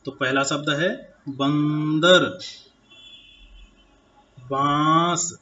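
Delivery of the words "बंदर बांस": बंदर and बांस said slowly, each syllable clear; slow demo words. Shown as a demonstration. Teacher to children, slow careful pronunciation demo. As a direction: spell out the nasal sounds for pupils. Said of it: "आप यह बोलते समय अंतर महसूस".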